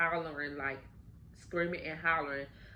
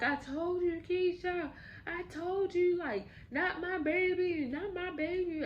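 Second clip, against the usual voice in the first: much higher, anguished mother's wail; wailing grief; 12 semitones higher than usual. Retold or imitated. Mother wailing over her daughter and grandson, much higher. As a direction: cry it out high, grieving her child. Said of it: "She just screamed her heart out".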